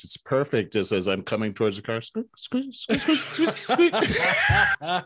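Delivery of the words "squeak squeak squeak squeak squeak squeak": high squeaky noise breaking into laughter; high squeak squeak with a laugh; imitated squeak. Squeak comes back again.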